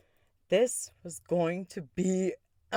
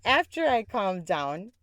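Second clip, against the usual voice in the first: usual speaking voice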